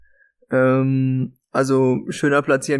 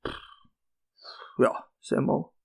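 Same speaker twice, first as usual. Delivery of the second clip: whispered; 8 dB quieter than usual. Real, not acted. Disappointed verdict on the match.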